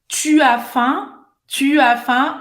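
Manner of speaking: "Tu as faim" slowly, every vowel kept; full school pronunciation. Shown as a demonstration. Teacher asking if you have heard this, "Tu as faim" in full, slowly. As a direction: sound the u of "Tu" before "as faim", rising at the end. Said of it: "os franceses falando assim".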